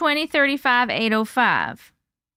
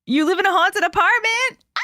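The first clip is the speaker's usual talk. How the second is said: surprised; 6 semitones higher than usual. Real, not acted. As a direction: react amazed to the news. Reacting to viewer's haunted home.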